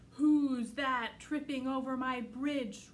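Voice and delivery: loud slow booming troll; roaring troll. Acted. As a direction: roar it out like a troll. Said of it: "roared the troll".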